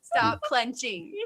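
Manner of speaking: slow, drawn-out teasing command; playful, stretched-out order; mock doctor's order. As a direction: draw it out like a patient instruction.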